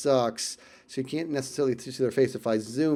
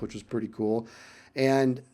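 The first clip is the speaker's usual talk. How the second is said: usual speaking voice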